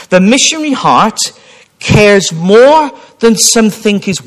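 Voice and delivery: slow, loud, high recitation of a saying; emphatic reading. Acted. Preacher reading a quote to the congregation, slow and loud. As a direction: lay each line down slowly and loudly.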